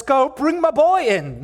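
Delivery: loud, high call to the servants; shouted command. Acted. Father to his servants about his returning son, shouting. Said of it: "he shouted out to his servants".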